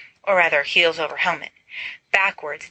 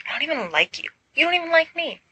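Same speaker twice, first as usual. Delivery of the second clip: irritated; 10 semitones higher, faster than usual. Acted. Narcissa rejecting Carmina.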